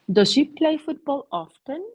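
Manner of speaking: high, loud question with stress on she; teacher modelling the question, rising at the end. Shown as a demonstration. Teacher shows the class a model question, high and loud. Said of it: "teacher models a question".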